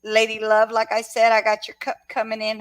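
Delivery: ordinary talk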